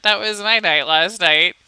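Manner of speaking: ordinary talk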